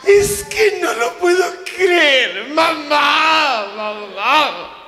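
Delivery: high, loud, slow wailed mamá; hammed-up despair; hysterical wailing. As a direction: daughter sobbing out accusations. Daughter wailing at mother.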